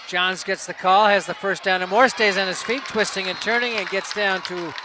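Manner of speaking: energetic football commentary; live play-by-play. Real, not acted. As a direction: follow the runner phrase by phrase.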